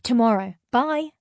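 loud goodbye yelled into phone. A narrator's reading. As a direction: shout it as you cut off the call. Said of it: "I yelled".

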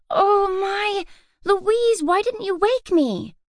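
put-on high, alarmed girl's cry; startled. A narrator's reading. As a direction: jolt awake in alarm. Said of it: "a startled".

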